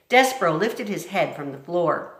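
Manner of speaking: ordinary delivery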